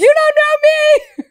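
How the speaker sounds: very high, loud screech; mock defiant yell. Confronting the imagined driver, hammed-up defiant scream. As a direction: scream it defiantly, playing it for laughs. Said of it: "I'd be like".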